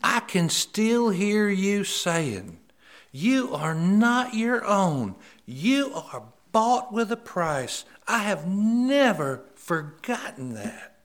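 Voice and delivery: high, slow, loud caller's voice; woman recalling his words. Retold or imitated. Woman on phone thanks the preacher, high and slow. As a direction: play the woman moved on the phone. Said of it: "she said".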